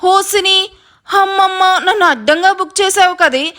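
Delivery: dismayed, accusing outburst; indignant realisation. Acted. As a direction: cry out at being framed. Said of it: "సీరియస్గా చూస్తూ చెప్తాడు".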